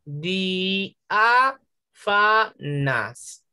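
four spaced, drawn-out beats: di, a, fa, nas; syllable by syllable. Shown as a demonstration. Teacher separating the hiatus for pupils, syllable by syllable. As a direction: DI - A - FA - NAS, each syllable apart. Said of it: "Hay cuatro sílabas".

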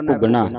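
ਘੁਗਣਾ run together, "kug-naa"; joined-up reading. Shown as a demonstration. Teacher showing students the reading, ਘੁਗਣਾ joined up. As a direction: say ਘੁਗਣਾ as one word. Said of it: "ਇਕੱਠਾ ਪੜ੍ਹਨਾ ਹੈ".

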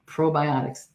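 ordinary delivery

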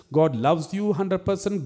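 ordinary talk, nothing marked

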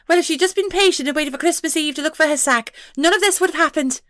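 high pixie voice, annoyed; tiny creature huffing at the fuss. A narrator's reading. Pixie to the detective, annoyed, in a high voice. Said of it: "She sounded annoyed".

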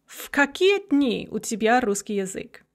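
"В какие" run together as "fkakie"; v devoiced to f. Shown as a demonstration. Teacher showing devoicing before a voiceless consonant, "В" said as f. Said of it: "it becomes fa".

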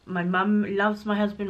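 normal talking voice

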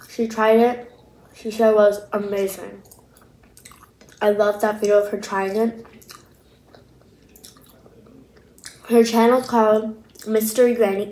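speaking between bites; talking while chewing. Real, not acted. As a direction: mouth partly full of food. Seen 1: Bites food at 1.3, then talks with jaw working, chewing visibly.

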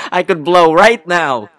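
high, very loud threatening shout; hot-headed tough-guy tirade; upset eruption. Acted. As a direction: blow up at the lawyer. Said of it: "Suddenly upset".

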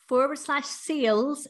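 sales in a Northern Irish accent. Real, not acted. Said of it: "sorry, my Northern Irish accent".

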